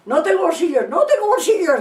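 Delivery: high, loud retold child's cry; Patxi gritando. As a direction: cry it out high and insistent, imitating. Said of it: "gritaba Patxi". (imitando a gritos agudos)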